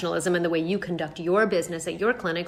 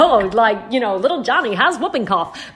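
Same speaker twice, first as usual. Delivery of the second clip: put-on old-timey newsreel voice, higher and louder; 1940s voice; 4 semitones higher, 8 dB louder than usual. Shown as a demonstration. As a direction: put on a quaint 1940s announcer voice. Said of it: "a nineteen forties voice".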